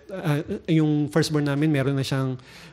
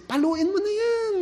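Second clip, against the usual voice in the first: much higher, slow strained voice; exasperated thought. Retold or imitated. Parents at the mall wishing someone would spank the crying child. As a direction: squeeze it out high like you're at your wits' end.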